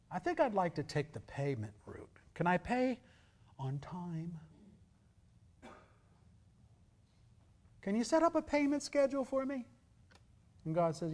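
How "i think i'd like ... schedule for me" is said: haggling sinner asking for terms, rising high at the end; mock sinner. Preacher mocking a sinner who bargains with God. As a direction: put on a pleading debtor asking God for a plan.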